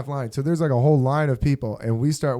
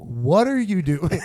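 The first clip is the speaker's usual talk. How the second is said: slow, stretched-out question; trippy slow-motion; 3 semitones higher, slower than usual. Retold or imitated. Kid confronting tripping line-cutter, heard in slow motion. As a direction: drag every word out as if time is warping.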